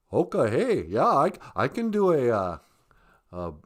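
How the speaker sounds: low-pitched put-on southern accent. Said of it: "Wait, I got a southern accent".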